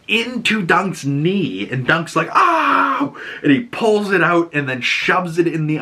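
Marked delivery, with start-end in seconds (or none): 2.3-3.1 high-pitched mock yelp of pain; imitated pain cry. Dunk takes a sword into his knee, mock pained yelp. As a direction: yelp as if stabbed, playing it up.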